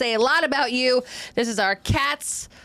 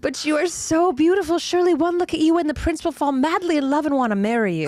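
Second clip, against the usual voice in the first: higher, gushing stage friend; supportive friend Crystal; 6 semitones higher than usual. Acted. Friend consoling Marie about the prince, gushing and pitched up. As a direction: reassure with hammed-up, high-pitched sweetness. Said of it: "I will be playing the role of Crystal".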